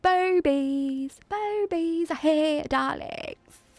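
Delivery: babies drawn out in a British accent; playful greeting in a mock British accent; put-on British accent. Shown as a demonstration. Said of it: "saying it in the accent".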